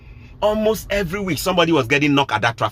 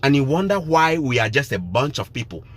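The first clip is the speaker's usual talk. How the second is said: normal talking voice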